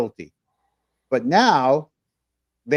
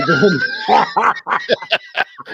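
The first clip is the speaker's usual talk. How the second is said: loud burst of laughter; high, helpless laughing fit; embarrassed laugh; 7 semitones higher, 6 dB louder than usual. Real, not acted. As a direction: crack up at being caught out. Caught mumbling, bursts out laughing.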